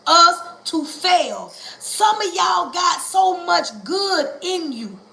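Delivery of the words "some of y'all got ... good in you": slow preaching shout. Acted. Preacher calling out slowly to the congregation. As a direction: call it out slowly to the crowd.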